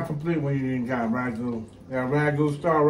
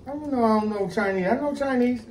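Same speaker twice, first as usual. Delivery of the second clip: high, fast playful protest; excited protest; 10 semitones higher, 3 dB louder than usual. Real, not acted. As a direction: insist on it, half joking. Playful argument about speaking Chinese.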